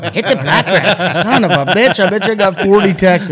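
loud, high and laughing; worked-up teasing, cracking up; mock badgering. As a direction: razz your friend through laughter. Mock-badgering friend, everyone laughing.

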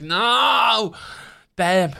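high, loud cry of protest; horrified shout. Retold or imitated. Viewer shouting at a pig being killed in a film, horrified. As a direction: shout it out at the screen in horror. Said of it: "I was like".

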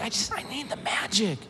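high, hushed imitation of pleading; retold desperate plea. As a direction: softly mimic a man at wit's end. Businessman pleads for the magic.

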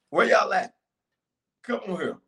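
ordinary talk, nothing marked

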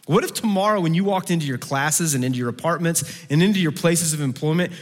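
usual speaking voice

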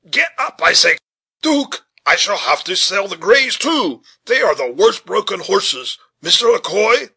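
high, loud, blustering complaint; agitated driver hollering at his team; agitated shouting. A narrator's reading. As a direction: shout in flustered exasperation at the horses. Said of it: "Richard was too much agitated".

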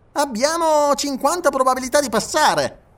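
high, projected enthusiasm; excited passenger. A narrator's reading. Passenger backing the engineer's plan, excited. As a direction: call out the odds in a high voice.

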